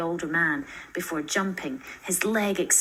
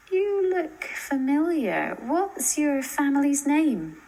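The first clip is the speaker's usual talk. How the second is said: high, slow and searching; lightly voiced teen girl, wondering aloud; curious girl's question; 8 semitones higher, 3 dB louder, slower than usual. Kiko peering at Lizzie, slowly curious.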